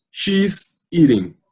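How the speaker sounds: She's eating said very slowly, word by word; slow model sentence. Shown as a demonstration. Teacher reading the picture caption to students, slow. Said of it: "aqui a explicação".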